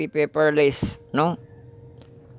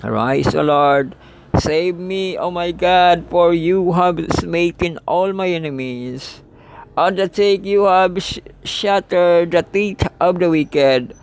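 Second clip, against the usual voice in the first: higher, louder declaiming of the psalm; scripture reading; 6 semitones higher, 3 dB louder, slower than usual. Acted. Teacher reading the close of Psalm 3 to the class, raised declaiming voice. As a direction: proclaim the verses to the congregation.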